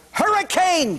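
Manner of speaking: high shout of hurricane; yelled. Acted. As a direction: yell it to make them scatter. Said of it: "he yelled".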